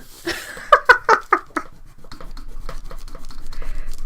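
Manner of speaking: silent high wheezing laugh; long high wheeze. Real, not acted. Having broken Dan, long wheezing laugh. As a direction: laugh until breath runs out.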